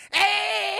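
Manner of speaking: high loud squeal; piercing mock shriek on being hit; Chris Tucker. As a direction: squeal it at the top of the range. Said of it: "squeals ever when she hits him".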